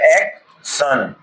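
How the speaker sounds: एक सन drawn out very slowly; slow spelled-out tion. Shown as a demonstration. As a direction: stretch out सन. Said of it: "इसको पढ़ते हैं हम सन".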